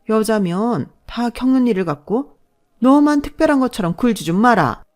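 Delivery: put-on scolding older-relative act with swooping pitch; affronted mother-in-law. Mother-in-law belittling pregnancy complaints, affronted scolding. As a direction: take offence and lecture her.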